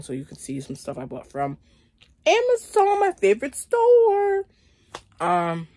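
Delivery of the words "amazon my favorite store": squeaky high excited voice; high sing-song gush. Acted. Gushing about favorite store.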